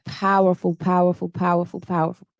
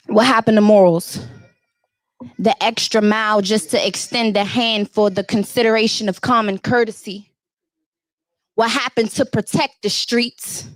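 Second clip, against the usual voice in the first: spoken word delivery, a touch higher and driving; spoken word poem; 4 semitones higher than usual. Acted. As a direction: perform it like a slam poem to the crowd. Poem performed for the crowd.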